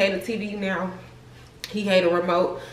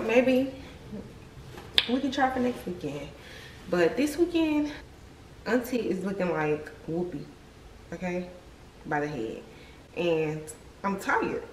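ordinary talk

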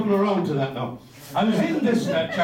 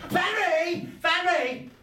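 high call across the shop; 10 semitones higher, much slower than usual. Retold or imitated. Old shop lady calling her colleague, high call. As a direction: call for Fanny from the back room.

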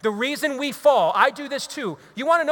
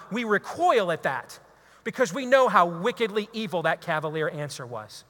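ordinary talk

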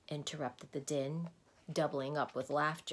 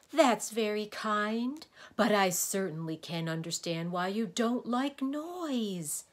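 high, loud, mournful complaint; groaning; 6 semitones higher, 6 dB louder than usual. A narrator's reading. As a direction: moan it out self-pityingly. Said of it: "groaned the din".

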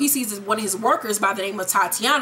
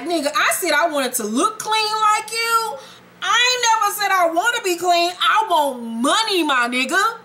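Dre pushing back; 8 semitones higher than usual. Acted. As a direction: push back hard, pitched up, defiant and blunt. Dre argues back with Ghost.

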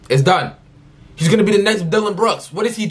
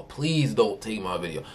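pleading; 9 semitones lower, 3 dB quieter than usual. Real, not acted. Begging YouTube not to remove.

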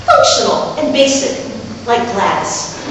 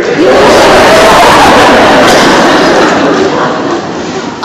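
loud laughing; 7 semitones higher, 6 dB louder than usual. Real, not acted. Laughing at own story.

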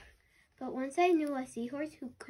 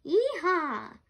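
cowboy whoop; 5 semitones higher, 6 dB louder than usual. Acted. Narwhal cheers like a cowboy.

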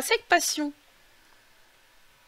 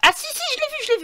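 excited; 6 dB louder, much faster than usual. Real, not acted. Spotting something on screen.

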